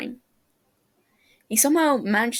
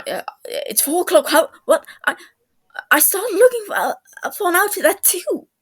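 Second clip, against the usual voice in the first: rising, louder, high-pitched panic; words tumbling out in alarm; panicked; 10 semitones higher, 6 dB louder than usual. A narrator's reading. James panicking at the time to his friend. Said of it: "read the panic on James's face".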